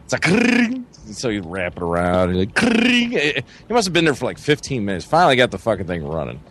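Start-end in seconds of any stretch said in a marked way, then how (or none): 0.2-0.8 high rolled whirr of a starter rope; rising mechanical trill; imitated pull-start motor. 2.5-3.1 high rolled whirr of a starter rope; rising mechanical trill; imitated pull-start motor.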